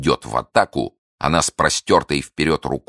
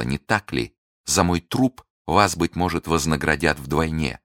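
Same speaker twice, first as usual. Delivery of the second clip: plain reading voice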